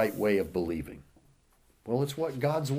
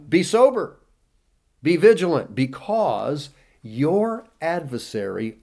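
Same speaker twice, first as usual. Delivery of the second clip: raised, ringing sermon reading; preaching scripture; 3 semitones higher, 5 dB louder than usual. Acted. Preacher reading the devil verse to congregation, forceful.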